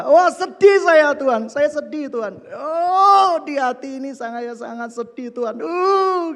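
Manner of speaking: mock sobbing. Preacher acting out his tearful prayer to God, mock sobbing. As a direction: wail it to God like an overdone sob.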